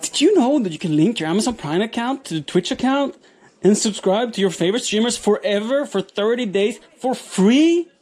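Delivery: high, bright salesman pitch, rising to a squeal on "free"; mock ad read. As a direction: sell it like a cheesy commercial.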